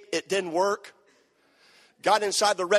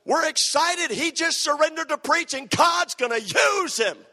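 high, hammed-up host, slowing on the last line; imitating an eager emcee; excited announcer; 8 semitones higher than usual. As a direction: hype the speaker to the crowd. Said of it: "They were the only ones excited".

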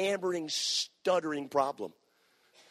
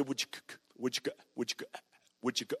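quiet, lower stammer stuck on each sound; stuttering; 5 semitones lower, 10 dB quieter, much faster than usual. Retold or imitated. Young man asking a girl out, quiet stuttering. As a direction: stammer out the question softly. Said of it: "I would stutter".

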